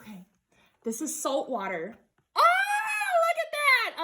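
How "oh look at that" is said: high, drawn-out delighted shriek; excited scream. Real, not acted. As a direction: scream with excitement at first sight. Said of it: "I know, I scream".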